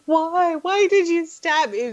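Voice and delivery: high, whiny fake sobbing plea; pathetic crying. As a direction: beg like a pathetic crybaby. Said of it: "Like, being super pathetic".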